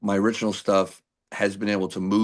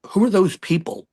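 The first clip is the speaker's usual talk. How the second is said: retold challenge pitched well up; indignant question; 10 semitones higher than usual. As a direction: push back, puzzled and a bit offended. Arguing with bass player.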